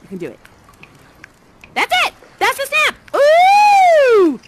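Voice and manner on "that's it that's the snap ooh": much higher, louder delight, ending in a big "ooh"; excited praise. Acted. To the child who snapped, a burst of much higher, louder praise.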